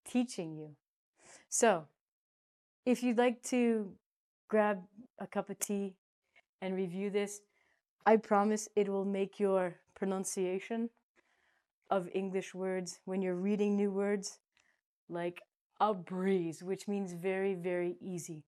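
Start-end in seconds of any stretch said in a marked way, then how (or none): none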